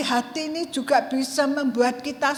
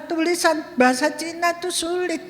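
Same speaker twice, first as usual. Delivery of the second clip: usual speaking voice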